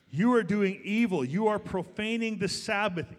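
loud and high, "are" punched; emphatic preaching. Real, not acted. Pastor restating Nehemiah's charge to church, loud and hard. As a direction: thunder the charge at them.

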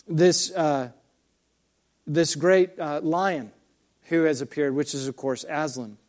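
ordinary delivery

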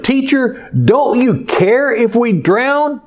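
panicked disciples wake their teacher; frightened disciples. Acted. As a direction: plead with him as the boat sinks.

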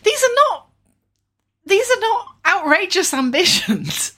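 high, loud, slow indignant protest; insist, hammed-up and wounded; mock outrage.

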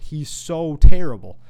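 loud and slow, leaning on terrible; furious shout. Real, not acted. Host yelling at the absent quarterback, loud and slow. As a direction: bellow the insult at full force. Angry rant peak.

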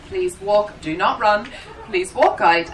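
usual speaking voice

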